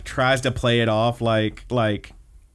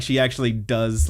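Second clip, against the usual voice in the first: ordinary talk, nothing marked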